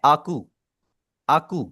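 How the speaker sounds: आकू separated out, starting on a clean "aa"; second part alone. Shown as a demonstration. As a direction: model आकू by itself for the learner. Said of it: "ही के बाद आपने बोलना है". (आकू को अलग से बोलकर)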